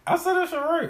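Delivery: high, loud protest; excited insistence. Real, not acted. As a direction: protest it with a squeal. Insisting loudly, laughing.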